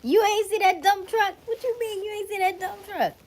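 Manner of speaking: very high, loud squeal of incredulity; playful high-pitched protest; mock disbelief. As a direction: squeak it out in hammed-up outrage. Playful disbelief at viewer missing it.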